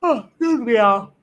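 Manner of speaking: words stretched through a yawn. Real, not acted. Streamer yawning at night, then excusing herself, yawning. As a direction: talk through a real yawn. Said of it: "I'm sorry for yawning".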